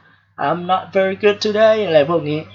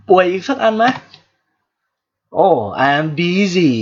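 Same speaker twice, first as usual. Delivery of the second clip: normal talking voice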